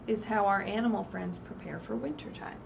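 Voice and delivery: ordinary delivery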